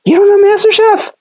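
can't believe they don't know it; high, amazed you-don't-know-this; incredulous. Real, not acted. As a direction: voice leaps way up in disbelief. Host never heard of MasterChef.

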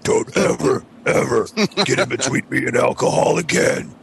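rasping mock shout, voice pushed up high; hammed-up metal singer growl, half yelled; Nathan Exposed. Retelling a cartoon scene to friends, mock rasping shout. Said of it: "Nathan Exposed is like".

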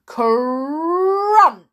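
rolled r, rising and loud; crunching effect. A narrator's reading. Heap landing on the floor, crunching sound. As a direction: grind through the word.

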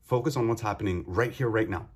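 usual speaking voice

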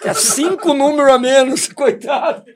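loud, high, laughing through the story; can't stop laughing as he tells it. Real, not acted. Laughing while telling shoe story. (rindo alto enquanto conta)

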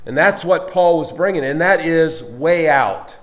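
loud, driving preacher delivery; preaching. Acted. Preacher urging the congregation that Christ lives within, loud. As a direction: build it up and press it home to the church.

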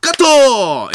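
loud, high call of cut; director's shout. Acted. Director halting a botched take, loud high shout. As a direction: holler to stop the take.

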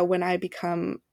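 ordinary talk, nothing marked

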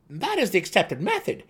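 high, loud gnome guess; eager gnome. A narrator's reading. Seldig eagerly guesses the method, high and loud.

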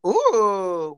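ordinary talk

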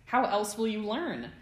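loud, high mock question; smug taunt. A narrator's reading. James crouched to pounce, smug taunt. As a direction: tease as if teaching a lesson.

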